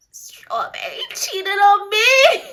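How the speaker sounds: high, loud, drawn-out tearful wail; mock crying wail. Daughter finally telling her mother she was cheated on, mock crying wail. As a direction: wail it through hammed-up sobs. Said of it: "I'm crying. When I finally composed myself".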